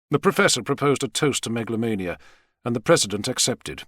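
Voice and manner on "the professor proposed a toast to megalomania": plain reading voice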